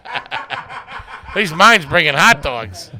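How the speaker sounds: loud, laughing outburst; laughing shout. Real, not acted. Laughing shout about his dad.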